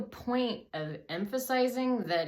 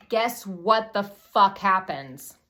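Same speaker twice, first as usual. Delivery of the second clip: loud, slow, higher, word by word; emphatic build-up; 4 semitones higher, 10 dB louder, slower than usual. Real, not acted. Storyteller teasing the awful twist, loudly emphatic. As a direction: punch out each word for suspense.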